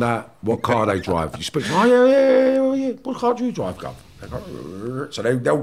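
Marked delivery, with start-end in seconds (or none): 1.7-3.9 butter him up like a matey geezer; chummy wide boy. Retold or imitated. Acting out chatting up informant.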